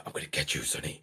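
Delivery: low, menacing whisper; dead menacing. Retold or imitated. Unseen voice threatening a child at bedtime, menacing whisper. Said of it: "Like, dead menacing".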